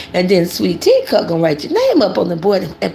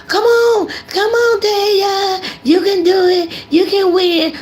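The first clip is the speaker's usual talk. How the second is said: cheering parent; 11 semitones higher, slower than usual. Retold or imitated. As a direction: hype the kid into fighting. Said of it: "and you're like".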